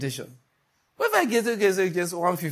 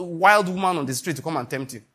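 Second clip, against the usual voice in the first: usual speaking voice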